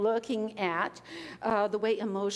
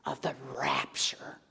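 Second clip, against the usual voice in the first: awed whisper; 9 semitones lower, 5 dB quieter than usual. Acted. Awed vision of the rapture.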